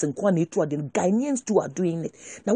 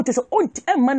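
normal talking voice

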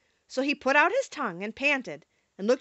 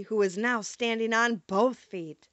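ordinary delivery

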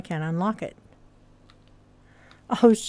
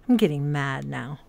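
usual speaking voice

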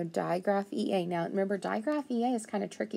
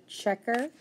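ordinary delivery

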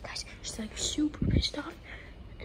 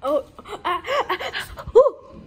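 laughing; 4 semitones higher, 10 dB louder, slower than usual. Real, not acted. Laughing during prank.

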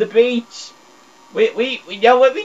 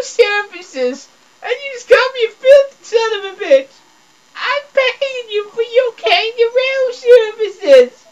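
high, wailing fake sob; mock crying outrage. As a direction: whine it like a hurt, pretend-crying man.

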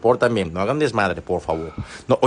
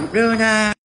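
calling the dog; 9 semitones higher than usual. Real, not acted. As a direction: sing the dog's name out high. Cooing at the dog.

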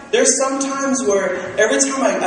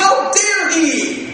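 mock outrage; 9 semitones higher, 4 dB louder, much slower than usual. Mock outrage at honest answer.

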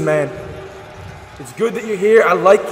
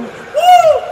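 party woo; 5 dB louder than usual. Acted. Crowd hype yell.

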